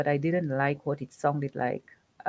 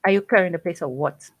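sharp call; 7 dB louder, faster than usual. Acted. Boss startles her from corridor.

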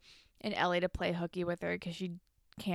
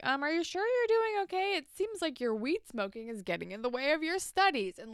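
voicing a concerned friend, higher at first; gently mock a worried friend checking in; 7 semitones higher than usual. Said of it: "like, being a concerned friend".

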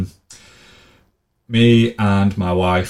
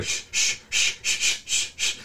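soft unvoiced shh shh like a guard; whispered mock shushing; imitated shushing; 6 dB quieter, slower than usual. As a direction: hush the crowd in a whisper. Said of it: "told to be quiet".

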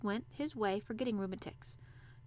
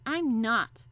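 firm, loud denial; curt and certain; decisive; 8 dB louder than usual. A narrator's reading. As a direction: state it with total decision. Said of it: "said it with such decision".

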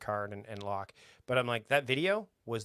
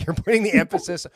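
laughing through words; 6 semitones higher, 13 dB louder than usual. Real, not acted. Laughing at his own joke.

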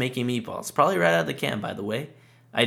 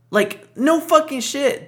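high, loud and slow, incredulous; exasperated outburst; 7 dB louder, slower than usual. Real, not acted. Ranting podcaster yells at a cartoon wife's obvious remark, exasperated. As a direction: shout it in disbelief at a dumb line. Exasperated reaction to Peggy quote.

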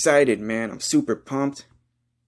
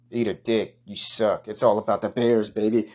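normal talking voice

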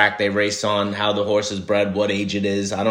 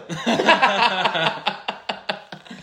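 laughing at own joke; 11 semitones higher than usual. Real, not acted.

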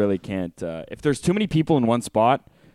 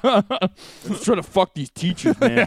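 mock bro voice; 9 semitones higher, 3 dB louder than usual. Jokingly plays a horny old student.

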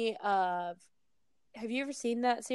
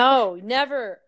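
loud, slower, emphatic refusal; emphatic objection; 2 semitones higher, 9 dB louder, slower than usual. Real, not acted. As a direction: cut in loudly and firmly forbid it. Objecting to summoning a demon.

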